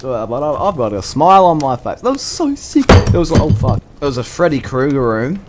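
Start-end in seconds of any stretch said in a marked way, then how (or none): none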